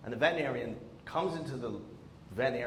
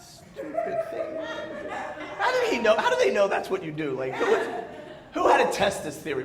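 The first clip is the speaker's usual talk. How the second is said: laughing; 9 semitones higher, 6 dB louder than usual. Real, not acted. Laughing through disbelief.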